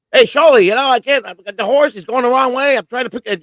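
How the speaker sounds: squeaky comic character voice; high-pitched impression. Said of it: "he came up with the horse".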